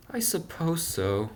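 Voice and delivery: high, slow, meek agreement; Punchinello's small voice. A narrator's reading. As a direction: give in timidly, high and small.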